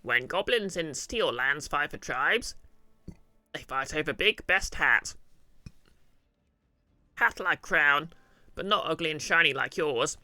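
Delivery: loud, slow goblin voice with big pitch swings; troubled goblin. Acted. Goblin recalling how the king beat him, troubled and loud. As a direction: play an uneasy goblin, loud and drawn out.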